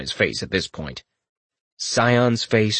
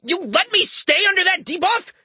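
much higher, sputtering indignation; spluttering outrage. Acted. Scion accusing his friend, high and spluttering. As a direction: splutter it out in disbelief. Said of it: "pure outrage as he spluttered".

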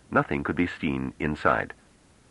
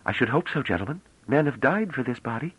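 put-on a young woman's higher voice, teasing; playful young woman; 8 semitones higher than usual.

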